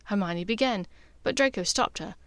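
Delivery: ordinary delivery, nothing marked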